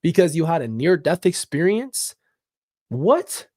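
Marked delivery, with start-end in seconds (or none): 2.9-3.5 incredulous. Real, not acted. Disbelief at blasphemy.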